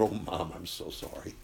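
fading into a weeping whisper; a daughter breaking down into her mother's neck, weeping softly; tearful apology. Retold or imitated. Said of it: "just wept in her mother's neck".

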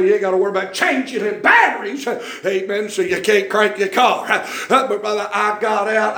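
ordinary delivery

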